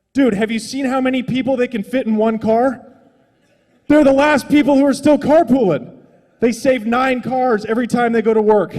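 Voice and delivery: rising to a near shout. Retold or imitated. Arguing back at his complaining boss, loud and high.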